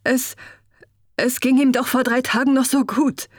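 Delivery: stockend und höher, fassungslos; stammelnd, Stimme steigt höher; gestammelt. A narrator's reading. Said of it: "stammelte er". (verzweifelter Prinz stammelt, stockend und höher)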